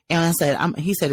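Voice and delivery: normal talking voice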